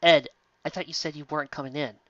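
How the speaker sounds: usual speaking voice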